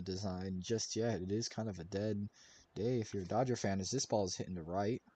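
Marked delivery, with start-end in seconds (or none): none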